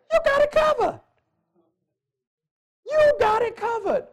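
high-pitched exclamation; exultant preaching cry. Real, not acted. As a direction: proclaim it high, riding on one held pitch. Preacher exclaims at sermon's high point.

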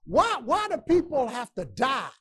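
ordinary talk, nothing marked

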